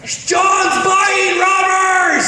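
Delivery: very slow, high drawn-out yell; second friend announcing it across the store, slow high shout; yelling it back. Retold or imitated. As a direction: stretch it out so the whole shop hears. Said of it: "To which Tim replies".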